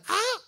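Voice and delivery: high mock yelp of pain; sharp high cry of a burned kid; retold yelp. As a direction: yelp out as the boy did. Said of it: "and I went".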